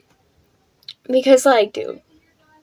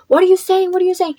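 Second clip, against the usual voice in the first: alarmed high cry; 9 semitones higher, faster than usual. Retold or imitated. Panicking as brother grabs phone.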